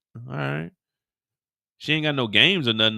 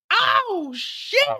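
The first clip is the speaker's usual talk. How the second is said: shrieked oh shit, way up high; high shocked yelp. Retold or imitated. Bystander reacting to a gunshot, high panicked squeal.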